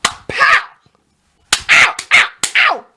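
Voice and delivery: squealing high pow and ow ow cries; mock beaten man. Host mocking a guest getting spanked, high squeals. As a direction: squeal like you're being slapped around. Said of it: "Mister Taylor's like".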